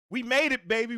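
high, loud celebration; excited shout. Real, not acted. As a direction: cheer it out to the crowd. New year celebration shout.

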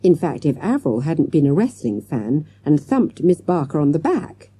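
plain reading voice

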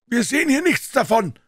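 high, loud call from upstairs; shouted reply. A narrator's reading. Man above to Puh, shouted reply. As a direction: holler it down to the street. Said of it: "antwortete der Mann von oben".

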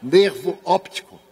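loud, self-amused show of a posh-sounding term; mock-chic. Shown as a demonstration. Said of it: "É tão chique".

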